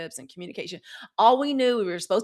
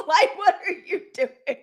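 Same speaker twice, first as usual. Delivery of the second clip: high, loud laughing question; laughing while mocking the man; 9 semitones higher, 6 dB louder than usual. Real, not acted. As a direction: ask it through laughter. Laughing rant.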